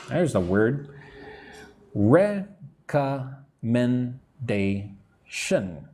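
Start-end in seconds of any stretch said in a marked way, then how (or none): 1.9-5.9 "Recommendation" in five pieces, rec-com-men-da-tion, with gaps; syllable by syllable. Shown as a demonstration. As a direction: count out "Recommendation" very slowly. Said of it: "Five syllables".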